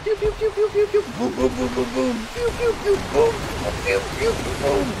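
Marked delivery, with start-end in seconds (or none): 0.0-1.0 high pew pew zaps; laser gun sounds. Pretend. 1.2-2.3 booming mouth explosions; explosion sounds. Pretend. Mimicking explosions. 2.3-3.3 high pews ending in a boom; laser gun sounds. Pretend.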